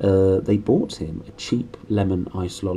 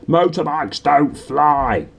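slow, higher, louder bellow of an angry uncle; Uncle Vernon yelling; 6 semitones higher, 5 dB louder, much slower than usual. A narrator's reading. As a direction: drag out each word like a furious red-faced uncle. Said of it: "yelled at Harry".

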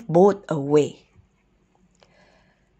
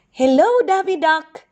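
high, bright-timed greeting called across; calling out; 10 semitones higher, 4 dB louder than usual. A narrator's reading. Little turtle greets the duck on the dock, calling out. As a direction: call hello in a much higher voice. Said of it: "Dee Dee called".

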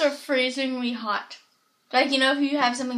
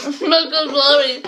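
laughing; 7 dB louder than usual. Real, not acted. Laughing during popsicle challenge.

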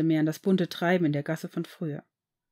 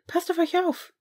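called out much higher; calling after; 11 semitones higher than usual. A narrator's reading. Narzissa calls after the departing father and son, high-pitched. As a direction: send it after them as they leave. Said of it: "rief Narzissa ihnen noch nach".

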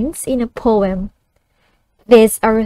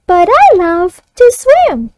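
put-on higher child voice echoing back; 6 semitones higher, 4 dB louder than usual. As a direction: echo it like a young pupil. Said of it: "the child reads it back".

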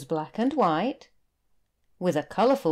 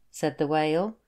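ordinary delivery

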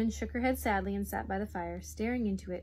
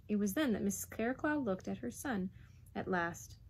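ordinary delivery, nothing marked

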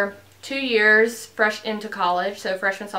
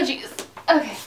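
ordinary delivery

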